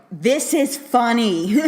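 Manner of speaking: hammed-up self-aware joke; mock telegraphed joke. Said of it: "the jokes are kind of like".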